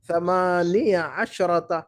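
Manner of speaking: ثمانیہ عشرة read slowly, each syllable drawn out; slow model reading. Shown as a demonstration. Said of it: "یہ ایسا پڑھیں گے". (آہستہ آہستہ پڑھ کر سکھانا)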